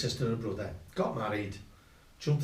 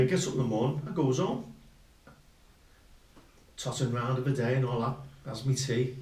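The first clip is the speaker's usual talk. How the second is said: ordinary delivery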